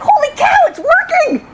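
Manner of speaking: very high, loud excited exclamation; amazed delight. Retold or imitated. Officer seeing the training work, thrilled outburst. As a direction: squeal it in happy disbelief. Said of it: "And then I was like".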